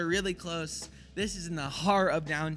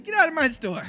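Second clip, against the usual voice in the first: higher, louder, laughing through it; laughing order; 9 semitones higher, 5 dB louder than usual. Retold or imitated. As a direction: kick him out while cracking up. Ordering the thief out.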